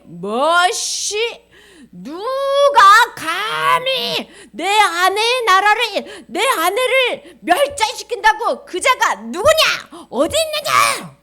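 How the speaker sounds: high, loud, drawn-out royal rage; furious shouting. Acted. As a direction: roar slowly as an outraged king. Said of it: "화가 나서 소리 소리 지르는". (격노하여 소리 지르는 임금)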